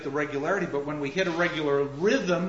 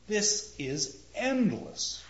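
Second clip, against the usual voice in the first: slow, stretched phrase; slow, lingering delivery; drawn out; 3 semitones higher, slower than usual. Shown as a demonstration. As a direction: stretch endless out long. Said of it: "It's drawn out".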